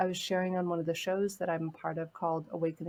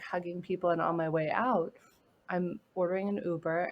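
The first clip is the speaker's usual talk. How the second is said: ordinary delivery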